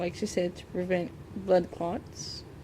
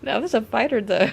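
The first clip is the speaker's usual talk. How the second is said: laughing; 3 semitones higher, 8 dB louder, faster than usual. Real, not acted. Laughing about stubborn bubble.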